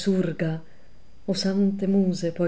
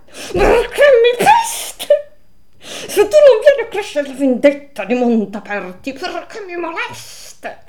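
weeping scold; 11 semitones higher, 8 dB louder than usual. A narrator's reading. A kicked soul in the ice scolds Dante, sobbing and high. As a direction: wail the reproach through tears. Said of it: "Piangendo mi sgridò".

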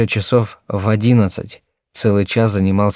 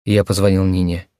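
usual speaking voice

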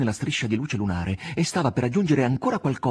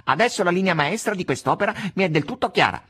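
high-pitched cat character; eager know-it-all cat; 9 semitones higher, 4 dB louder than usual. Acted. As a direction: chime in brightly as the cat. Cat chimes in.

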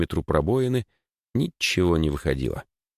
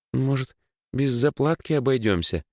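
put-on timid, higher-pitched girl's suggestion; робкое предположение; 8 semitones higher than usual. A narrator's reading. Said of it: "робко предположила она". (робко, повыше)

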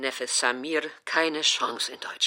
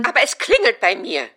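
high and loud on "klingelt"; mother digs in loudly; stubborn loud insistence; 7 semitones higher, 9 dB louder than usual. Acted. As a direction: push back hard, stressing "klingelt".

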